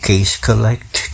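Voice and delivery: case collect, drawn out slowly word by word; slow mocking drawl. Mocking youngsters' money hustle, said slowly and drawn out.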